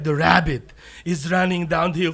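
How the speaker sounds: ordinary delivery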